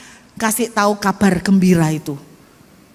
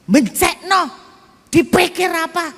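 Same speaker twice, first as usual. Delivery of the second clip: husband's angry rant; 6 semitones higher than usual. Retold or imitated. Husband ranting at the landlady after the eviction, mock angry. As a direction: imitate the husband venting his anger. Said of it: "Dia pokoknya marah, sama ngomel-ngomel-ngomel".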